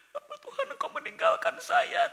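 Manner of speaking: mock wail. Mock lament to God.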